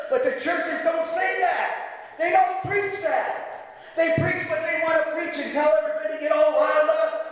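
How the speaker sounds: high, impassioned preaching shout; fiery preaching. Real, not acted. Preacher railing at the churches, impassioned shout. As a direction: rise up and preach it hard.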